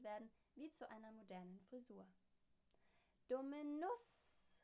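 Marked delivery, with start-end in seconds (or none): 3.3-4.5 high, loud cheeky shout; calling out. A narrator's reading. Machine shouting an insult at the neighbour. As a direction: yell the insult back. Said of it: "ruft die Sprechmaschine".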